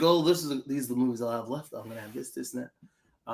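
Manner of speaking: low, soft voice trailing away, meant as Denzel; failed Denzel impression. Host quoting Denzel's interview about his last movies, botched low impression. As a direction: attempt a Denzel, dropping low and quiet. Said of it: "that's not a Denzel voice at all".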